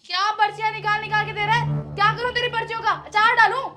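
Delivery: loud, mocking scold rising to the end; mocking angry rant at the delivery man; sarcastic anger. Sarcastic scolding over the slips.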